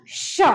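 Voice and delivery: "श" with a long rubbing hush; palatal sh with friction. Shown as a demonstration. Teacher showing where friction happens, drawn-out "श". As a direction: stretch the friction at the start of "श". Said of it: "श बोलते हैं तो घर्षण हो रहा है".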